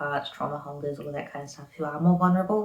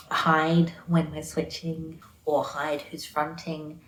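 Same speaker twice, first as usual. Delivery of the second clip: ordinary talk, nothing marked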